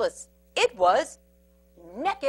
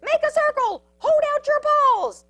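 very high, loud, urgent orders; frantic little commander; Pip yelling; 13 semitones higher, 8 dB louder than usual. A narrator's reading. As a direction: shout rescue orders in a high voice. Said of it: "yelled Pip".

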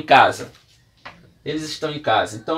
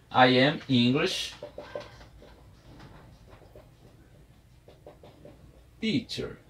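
slow dictation; 3 semitones lower, 5 dB quieter, slower than usual. Shown as a demonstration. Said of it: "teacher models the phrase".

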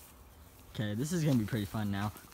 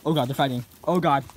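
normal talking voice

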